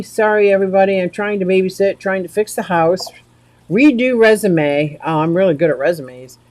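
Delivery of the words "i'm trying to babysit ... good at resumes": ordinary talk, nothing marked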